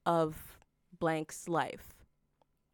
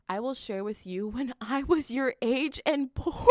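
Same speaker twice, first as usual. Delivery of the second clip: reading aloud as laughter breaks through; higher and louder, cracking up mid-line; laughing while reading; 5 semitones higher, 4 dB louder than usual. Real, not acted. Reading the boss's text aloud, breaking into laughter.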